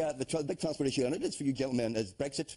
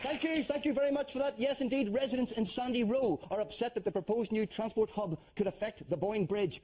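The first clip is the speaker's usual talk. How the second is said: host news read; 9 semitones higher than usual. Acted. Host reads news item.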